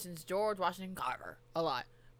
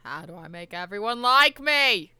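quoting JFK; 2 semitones higher, 8 dB louder than usual. Retold or imitated. Imitating JFK line loudly.

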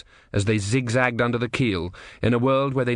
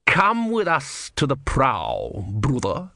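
menacing inquisitor; 3 semitones higher, much slower than usual. Acted. Vorbis commands softly.

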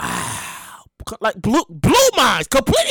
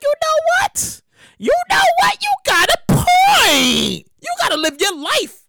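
excited realization; 13 semitones higher than usual. Real, not acted. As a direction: shout it as it finally clicks.

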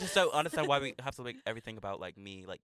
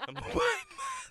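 mock imitation; slower than usual. Mocking [name]'s claim.

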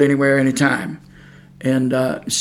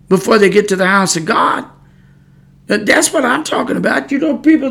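higher-pitched, fervent preaching to the flock; rising preaching; 7 semitones higher than usual. Acted. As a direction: lift the voice and preach it to them.

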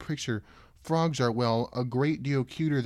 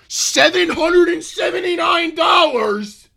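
loud, high, slowed outcry of disbelief; shocked shout; 10 dB louder, slower than usual. Real, not acted. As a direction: shout the price in utter shock. Shocked at the price.

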